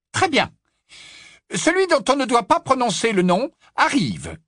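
high, grave stern teacher voice; stern old witch. Acted. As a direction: announce it gravely. McGonagall voiced.

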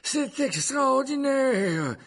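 high, drawn-out mock astonishment; amused. A narrator's reading. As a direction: stretch it out with sly amusement. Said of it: "avec une nuance d'amusement dans la voix". (ton amusé, voix haute et traînante)

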